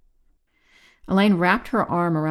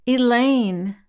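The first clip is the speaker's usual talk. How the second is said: high, loud wail of a name; acted wail, pitched up and loud; 6 semitones higher, 6 dB louder than usual. A narrator's reading. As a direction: cry out for a rescuer in distress. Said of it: "Doro wailed".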